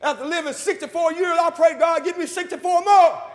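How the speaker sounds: high, loud pulpit proclamation; full-force preaching. Real, not acted. Preacher thankful after sixty-four years, full-force preaching. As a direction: ring it out to the congregation.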